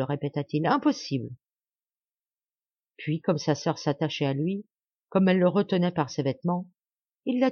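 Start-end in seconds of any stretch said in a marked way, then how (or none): 0.7-1.3 impossible said lower and hoarser, falling to an end; rough-voiced final no; hoarse refusal. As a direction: brother repeating his refusal to his sister. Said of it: "d'une voix rauque".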